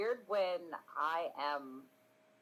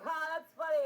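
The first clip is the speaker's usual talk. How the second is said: high, louder mock crying; imitated crying; 13 semitones higher, 6 dB louder than usual. Streamer imitates her crying sound alert, mock tearful. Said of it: "one person's crying, and it was like".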